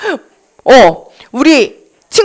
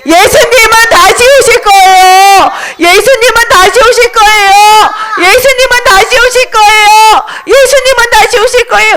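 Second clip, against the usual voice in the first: high, ringing proclamation; rousing street-preacher call; evangelist's cry; 8 semitones higher than usual. Acted. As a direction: announce it high to a whole crowd. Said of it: "이렇게 말했어".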